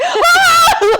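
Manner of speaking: very high, loud yelped scream; laughing mock shriek of terror; comic retold scream. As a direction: let out a startled high scream. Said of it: "like, screaming".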